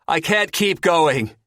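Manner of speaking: high, loud, drawn-out call. A narrator's reading. Exhausted Terry to the teenagers ahead, calling out. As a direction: call ahead, slowly stretching it. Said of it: "Terry calls out".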